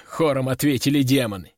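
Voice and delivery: ordinary delivery, nothing marked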